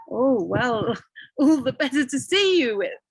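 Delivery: put-on the wolf teasing the girl with a laugh. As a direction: sly answer through a laugh.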